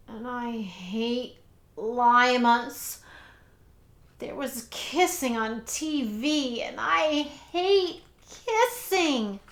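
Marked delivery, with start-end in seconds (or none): 0.1-3.0 slow disgusted drawl; slow, grossed-out complaint. A narrator's reading. 6.6-9.4 slow disgusted drawl; slow, grossed-out complaint. A narrator's reading.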